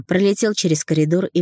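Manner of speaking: plain reading voice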